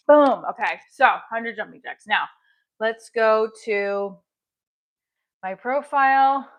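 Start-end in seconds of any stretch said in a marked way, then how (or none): none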